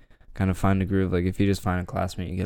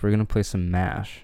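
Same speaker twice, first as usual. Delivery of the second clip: usual speaking voice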